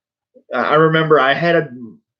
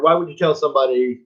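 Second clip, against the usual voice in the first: normal talking voice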